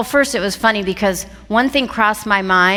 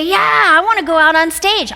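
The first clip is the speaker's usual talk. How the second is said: higher and louder, keen to jump on stage; bright, pushy excitement, raised and louder; eager volunteer; 8 semitones higher, 4 dB louder than usual. Retold or imitated. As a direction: play the eager one who can't wait to go on. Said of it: "some of you would say".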